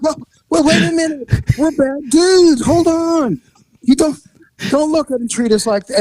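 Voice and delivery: high-pitched wounded bravado; mock indignant protest. Acted protest at being dismissed.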